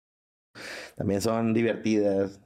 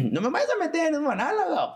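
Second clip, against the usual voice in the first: much higher, pleading woman's voice; mock woman pleading. Comedian mimics women begging to stay out of his act, high-pitched. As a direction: squeak it out like a worried woman. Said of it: "y luego me dicen".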